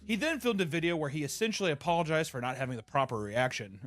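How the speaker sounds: normal talking voice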